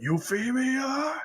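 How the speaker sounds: very high, drawn-out call of a name; mock dramatic cry. Acted dramatic cry of her name.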